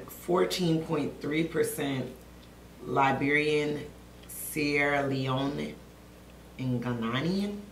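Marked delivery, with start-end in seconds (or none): none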